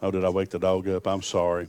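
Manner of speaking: ordinary talk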